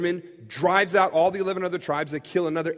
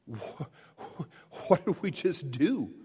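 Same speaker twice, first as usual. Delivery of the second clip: stunned realization; 4 semitones lower, 6 dB quieter, slower than usual. Retold or imitated. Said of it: "they kinda go".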